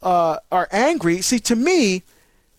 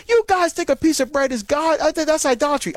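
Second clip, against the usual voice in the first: hostile questioner; 7 semitones higher than usual. Retold or imitated. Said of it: "someone say".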